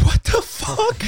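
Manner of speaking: squeaky, high stunned what the fuck; a mock shocked yelp, way up high. Friend hears a fan admit bigotry, shocked squeak.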